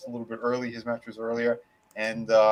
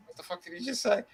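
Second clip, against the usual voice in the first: leaning-in aside; 7 semitones higher, 8 dB quieter, faster than usual. Retold or imitated. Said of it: "he's leaning over going".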